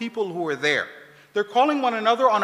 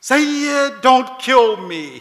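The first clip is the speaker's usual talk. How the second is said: louder, slow plea for his life; old man begging the leader not to kill him, louder and slow; 5 semitones higher, 8 dB louder, much slower than usual. Retold or imitated. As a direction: plead to the Sayyid, dragging it out. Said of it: "the guy starts begging".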